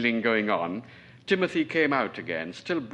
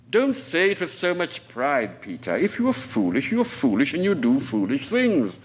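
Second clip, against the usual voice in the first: scolding; 4 semitones higher, 6 dB louder than usual. Acted. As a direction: press each word home as a stern rebuke. Colonel scolds servant.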